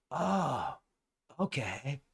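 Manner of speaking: soft, breath-light "oh, okay"; mock deflated reply. Believers told it is artificial intelligence, softly deflated imitation. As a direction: imitate someone quietly backing down.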